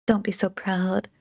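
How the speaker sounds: whispered tease; teasing whisper. Acted. Tutor teasing the proud pupil, whispered. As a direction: whisper the jab playfully.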